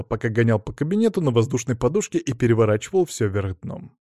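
ordinary delivery

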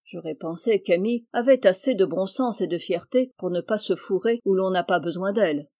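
usual speaking voice

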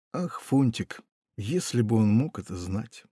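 ordinary delivery, nothing marked

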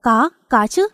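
mildly eager, stuttered yes. A narrator's reading. Simple husband answering his wife's offer, mildly eager. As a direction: jump at it with a touch of eagerness. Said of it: "nó vồ vập".

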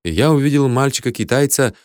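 ordinary delivery

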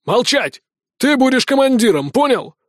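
high-pitched commanding rebuke; angry order. Acted. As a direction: shut the objection down. Colonel silences his assistant's objection. (гневный приказ)

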